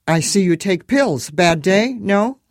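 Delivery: high, loud Eastern European cabbie voice; thick Moldovan accent, chatty and friendly. A narrator's reading. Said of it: "This one had an accent".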